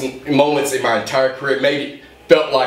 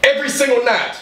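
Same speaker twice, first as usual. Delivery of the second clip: rising higher and higher into a fired-up cry; building passionate promo; 6 semitones higher than usual. Acted. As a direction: pour everything into it, climbing to a peak. Climax of an emotional promo.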